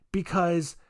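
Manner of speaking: usual speaking voice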